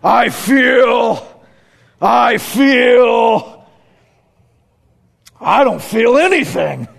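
big drawn-out impersonated preacher voice; Ken Graves. Retold or imitated. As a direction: groan it out, imitating a man waking from surgery. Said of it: "This is Ken Graves".

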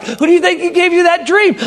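high, pressing pastor's question; emphatic questioning. Retold or imitated. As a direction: press the point, pitch up high.

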